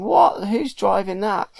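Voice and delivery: high, loud burst of alarm, then settling; mock alarm at a driverless tractor; shocked what. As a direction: yelp it out as the tractor comes at him. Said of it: "and he's thinking".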